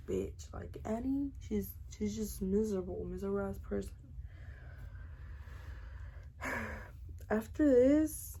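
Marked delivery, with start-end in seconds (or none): 4.0-7.2 long soft weary sigh; heavy exhausted sigh. Real, not acted. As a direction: let out a tired breath. Weary end of sad story.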